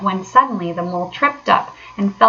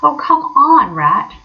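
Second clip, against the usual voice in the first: higher, louder impatient urging; 7 semitones higher, 4 dB louder, slower than usual. A narrator's reading. Mole waiting on the digging Rat, impatient. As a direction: hurry him up, fed up. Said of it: "the mole waited impatiently".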